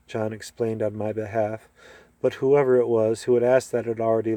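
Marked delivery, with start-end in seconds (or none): none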